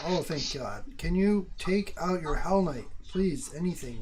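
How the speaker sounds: loud, high, urgent please; relieved pleading. Real, not acted. Relieved gamer begging his friend, loud and high. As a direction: beg him to act right now.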